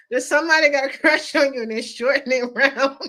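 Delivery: talking through real laughter; words breaking into giggles; cracking up. Real, not acted. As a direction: fight laughter while you speak. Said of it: "that had me cracking up".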